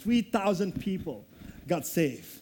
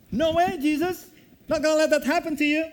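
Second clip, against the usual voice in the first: high, loud and fast insistence; fervent protest; 7 semitones higher, 5 dB louder, faster than usual. Acted. Peter insists to Jesus he won't allow it, in a fast, high, loud protest. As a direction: Peter cuts in, refusing to hear it.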